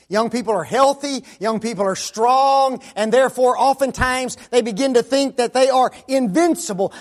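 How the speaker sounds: loud, high, ringing sermon build; preaching at full force. Acted. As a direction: preach it out hard, hammering each line.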